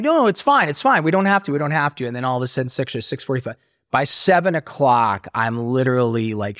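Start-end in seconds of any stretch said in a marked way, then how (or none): 0.0-2.1 high, hasty denial; nervous backpedal. Retold or imitated. A retold addict hastily denies to his girlfriend, high and flustered. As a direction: cover yourself, pitched well up.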